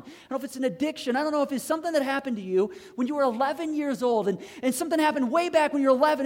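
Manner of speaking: loud, high-pitched, driving sermon delivery; impassioned preaching. Real, not acted. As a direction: preach it at full force, piling up each example. Preaches at full force.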